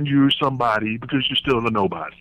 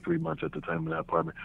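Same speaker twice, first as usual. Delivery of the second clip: ordinary talk, nothing marked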